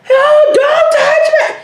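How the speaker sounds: high, drawn-out mock scream. Shown as a demonstration. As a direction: act out a child screaming for help. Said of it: "To scream it".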